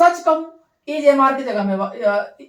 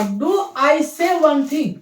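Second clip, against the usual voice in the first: slow teaching demo; 5 semitones higher, 3 dB louder, much slower than usual. Shown as a demonstration. Teacher presenting the board phrase, very slow and careful. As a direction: do I say one thing said very slowly, word by word. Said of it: "इसका अर्थ है".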